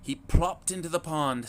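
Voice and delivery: ordinary delivery, nothing marked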